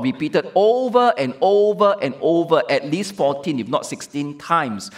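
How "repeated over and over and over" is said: ordinary talk, nothing marked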